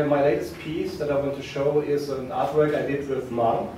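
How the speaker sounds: ordinary talk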